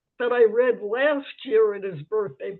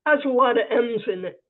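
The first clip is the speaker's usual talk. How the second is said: ordinary talk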